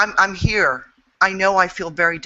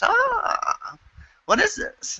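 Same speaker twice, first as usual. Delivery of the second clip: startled; 3 dB quieter, much slower than usual. Retold or imitated. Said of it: "and then I realized".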